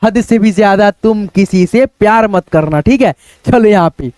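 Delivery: normal talking voice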